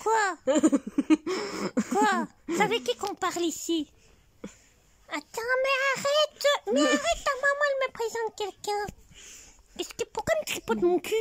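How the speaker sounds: high squeaky puppet voice for the teddy; teddy bear voice. Pretend. Grandmother voicing the teddy bear for her granddaughter, high and squeaky. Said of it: "et je parle comme ça avec lui".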